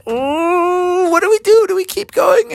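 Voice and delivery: high worried voices; worried family. Acted. The bears fret about the snowy road, high and worried. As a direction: panicky high cries. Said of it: "And they were like".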